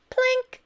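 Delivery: high, loud plink; high plink sound. A narrator's reading. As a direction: ring it like a falling can. Sound word in story.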